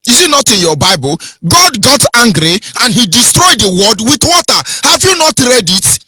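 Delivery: high-pitched, indignant preaching; angry. Real, not acted. Preacher defending his anger from the Bible, angry. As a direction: challenge the listeners with righteous anger. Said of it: "I'm saying it angrily".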